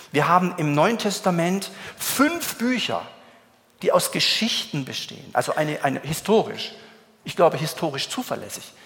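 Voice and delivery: ordinary delivery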